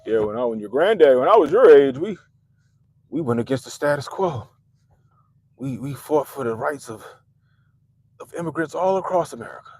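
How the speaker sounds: lightly voiced granddad bragging about the old days; a hint of a proud old protester; gently mocking a grandpa's war story; mock old activist. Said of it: "People just wanna say they did some shit".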